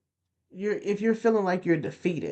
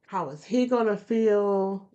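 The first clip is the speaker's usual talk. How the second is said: ordinary delivery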